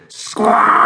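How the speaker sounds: long harsh screech; bird squawk. Acted. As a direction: let out a loud, drawn-out squawk. Reader imitates a bird's screech.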